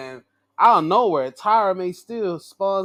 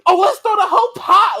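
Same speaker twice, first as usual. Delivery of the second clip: rising, louder, higher with each line; mimicking a crew escalating into chaos; mock overreaction; 13 semitones higher, 9 dB louder than usual. Vlogger mimicking the crew throwing the whole pot, escalating mock frenzy. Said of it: "they went with".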